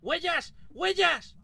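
shouting with excitement; excited shout. Real, not acted. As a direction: yell out the discovery. Finds ghost footprints, shouts.

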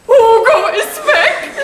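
high, loud, slow sobbing wail. Acted. Marlene wailing to Maxe that Hugo left her, high loud sob. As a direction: wail it out like a heartbroken woman. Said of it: "warum heulst du denn hier rum?".

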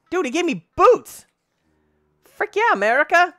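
high, loud cheering; thrilled shout. Real, not acted. Gamer cheering loudly after a villager gifts boots. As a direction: yell it with overjoyed disbelief.

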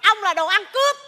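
heated marital bickering; angry accusation. Acted. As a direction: throw the accusation straight back. Acted couple trading accusations.

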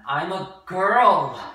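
very loud, slow, high outburst; girl's secret bursting out inside her head; blaring inner cry. Acted. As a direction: let the hidden truth blare out. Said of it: "words that blared through her brain".

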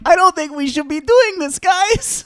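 high, nervous little voice; timid worrier. Retold or imitated. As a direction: play the anxious rule-follower. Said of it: "Goldobin's the one like".

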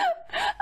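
high squeal of laughter. Real, not acted. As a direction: let out a high giggle. Laughing at the game.

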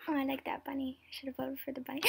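usual speaking voice

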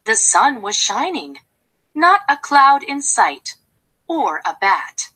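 ordinary delivery, nothing marked

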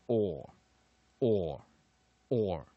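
or drawn out slowly, three separate times; R-controlled diphthong. Shown as a demonstration. Teacher sounding out the first syllable, long separate or. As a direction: glide a long vowel into curled R on or. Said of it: "所以它念".

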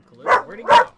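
startled shout. Real, not acted. Losing track of Kahlua.

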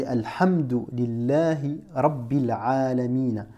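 Alhamdu lillahi Rabbil Alamin, recited slowly with rolled R; Quranic recitation. Shown as a demonstration. Teacher reciting the verse from Surat al-Fatiha. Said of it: "quand tu dis".

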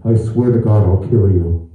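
low, slow, loud threat through a mask; mask-muffled threat. Shown as a demonstration. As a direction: menace the son from under the mask. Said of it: "you sound like this all the time".

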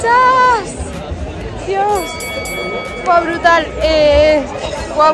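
high, loud, jubilant after the finish; thrilled and out of breath; excited. Real, not acted. Al cruzar la meta. (eufórica tras la meta)

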